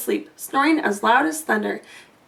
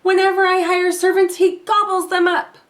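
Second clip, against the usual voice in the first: high, loud complaining giantess; a harried giantess grumbling about her husband; 6 semitones higher, 3 dB louder than usual. A narrator's reading. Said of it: "the huge woman roared".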